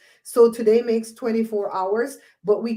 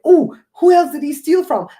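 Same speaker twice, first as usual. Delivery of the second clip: much higher, louder curious exclaim; surprised question; 8 semitones higher, 9 dB louder than usual. Retold or imitated. She retells finding a thief video, pitched up in surprise. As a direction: sound genuinely intrigued. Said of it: "I'm like".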